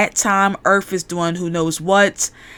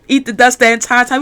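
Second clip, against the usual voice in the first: ordinary talk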